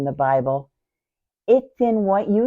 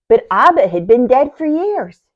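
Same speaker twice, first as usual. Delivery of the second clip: higher, louder burst of disbelief; bewildered protest; 7 semitones higher, 7 dB louder than usual. Acted. Sister objects that Abba is long dead, bewildered protest. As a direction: protest in raised, baffled voice.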